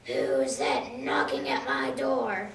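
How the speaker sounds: wolf in grandma's bed calling out, deep and slow; rough wolf voice. Acted. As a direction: rumble it like the disguised wolf. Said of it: "A rough and familiar voice called out".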